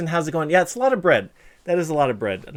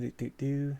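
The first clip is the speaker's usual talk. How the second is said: ordinary talk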